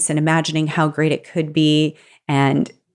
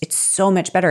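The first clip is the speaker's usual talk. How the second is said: ordinary talk, nothing marked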